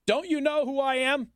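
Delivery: mocking the mayor's who-do-you-think-I-am tone; mock entitled mayor. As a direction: whine it like an entitled bigshot. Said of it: "That's what she just pulled".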